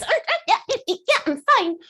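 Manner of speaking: very high, giggly mock nervousness; nervous fakery. Coach showing someone walking in with bottled fear, mock-nervous. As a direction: squeak through jittery fake laughter. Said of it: "then you walk in with this".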